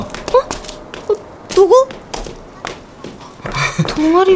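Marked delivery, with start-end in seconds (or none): 0.0-1.9 high startled gasp. Acted. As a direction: caught off guard. Startled by her name.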